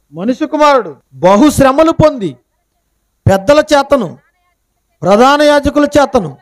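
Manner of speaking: paced scripture recital, pausing between phrases; solemn scripture reading. Acted. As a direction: intone the verse like a preacher at the pulpit. Reads scripture verse aloud.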